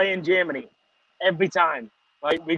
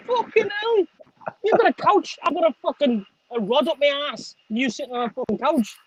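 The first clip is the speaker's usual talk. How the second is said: high, excited mock rant; laughing rant; 8 semitones higher than usual. Real, not acted. Ribbing Diesel about his geezer glide, high and laughing. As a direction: rib him about his couch on wheels, pitched up.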